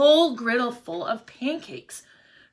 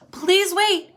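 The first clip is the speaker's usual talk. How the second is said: high, louder plea to wait; flustered plea; 10 semitones higher, 5 dB louder than usual. A narrator's reading. Pancake man begs the line to wait, flustered. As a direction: hold back the hungry crowd. Busy cook overwhelmed by line.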